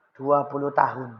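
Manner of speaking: hushed, humble confession; quiet reveal. Acted. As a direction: let the number land gently.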